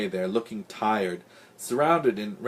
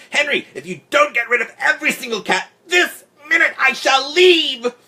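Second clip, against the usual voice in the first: high, loud ultimatum slowing at the end; hysterical, enraged screeching; furious shout; 8 dB louder than usual. A narrator's reading. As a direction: scream the threat, stretching the final words. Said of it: "Farrah shouted".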